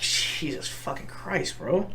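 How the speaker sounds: a hushed, drawn-out whisper of disbelief; stunned whisper. Retold or imitated. Reacting to a friend's suicide song, stunned slow whisper. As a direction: breathe it out slow, hushed and floored. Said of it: "again, I was like".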